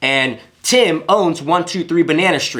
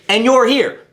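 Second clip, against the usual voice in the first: sudden loud, higher "here"; loud contrast; 8 semitones higher, 5 dB louder than usual. Shown as a demonstration. Coach showing a mismatched caller, loud. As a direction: burst out at full voice. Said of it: "and you get on the phone like this".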